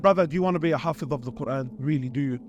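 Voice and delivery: dropped-pitch rhetorical question to a brother; low aside question. Acted. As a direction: sink right down low as you ask. Mock-asking audience an obvious question.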